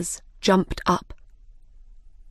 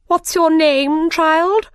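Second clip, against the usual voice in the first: imperious queen; 9 semitones higher, 5 dB louder, slower than usual. Acted. The Queen questions Alice.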